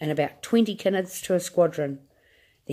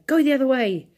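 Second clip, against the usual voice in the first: high, louder frightened yell; 7 semitones higher, 5 dB louder than usual. A narrator's reading. Grandma yelling at Wonka, high and loud. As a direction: yell it at the driver. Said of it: "yelled Grandma Georgina".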